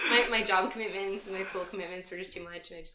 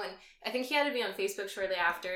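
ordinary talk

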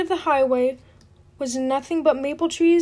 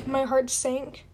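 usual speaking voice

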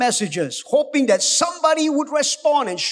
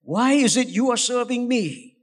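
ordinary talk, nothing marked

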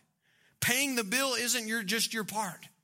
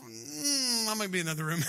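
mock retreat. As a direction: back out of it sheepishly. Husband backing out of the argument.

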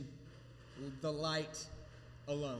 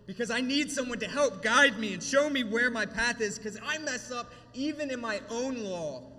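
fervent, rising sermon voice, louder at the peak; emotional preaching; 4 semitones higher, 5 dB louder than usual. Acted. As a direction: pour your heart into the sermon.

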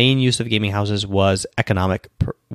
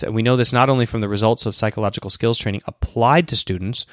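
usual speaking voice

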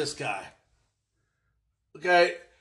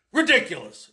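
ordinary talk, nothing marked

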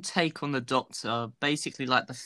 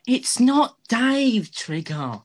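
high, slow whiny Rodney voice; indignant put-upon young man; Rodney impression; 10 semitones higher, 5 dB louder, much slower than usual. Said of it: "the Rodney from Only Fools and Horses".

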